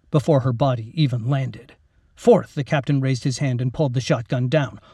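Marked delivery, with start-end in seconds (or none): none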